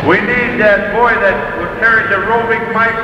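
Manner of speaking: slow, measured arena announcer; powwow emcee. Real, not acted. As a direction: call out names to the crowd.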